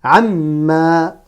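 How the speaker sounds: each word isolated and drawn out; deliberate Arabic reading model; detached word-by-word. Shown as a demonstration. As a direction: hold the sounds, pause between words. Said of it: "chaque mot de manière détachée".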